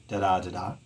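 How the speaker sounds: da-DA twice, light and even; two iambs. Shown as a demonstration. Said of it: "iambic dimeter".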